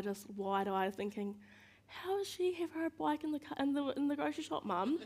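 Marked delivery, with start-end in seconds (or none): 1.9-5.0 put-on higher, childlike wondering voice; curious little kid. Child asks mum about the wheelchair, wide-eyed high voice. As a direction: play a wide-eyed small child asking.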